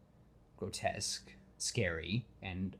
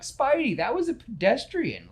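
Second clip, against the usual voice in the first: mock scolding; 13 semitones higher, 6 dB louder than usual. Real, not acted. Telling off Spider-Man.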